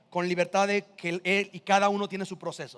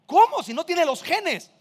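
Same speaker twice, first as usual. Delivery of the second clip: high, loud burst of disbelief, then slowing; acted astonishment; 8 semitones higher, 5 dB louder, slower than usual. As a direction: exclaim in hammed-up amazement. Acted astonishment at adopted child's resemblance. (asombro exagerado, agudo y fuerte)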